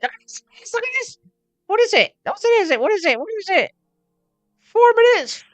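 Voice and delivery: squeaky, very high mocking voice; excited falsetto mimicry. Acts out excited people showing views.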